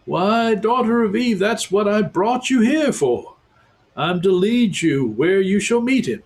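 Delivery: high, hearty beaver voice; a beaver welcoming guests; talking beaver. A narrator's reading. Beaver telling Susan why he brought them, high and hearty.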